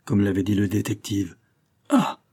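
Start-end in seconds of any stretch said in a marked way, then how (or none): 1.9-2.2 high-pitched "Ah" of astonishment; a short, high exclamation of marvel; amazed exclamation. A narrator's reading. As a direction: cry out in wonder, pitch leaping up. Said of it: "s'écria-t-il".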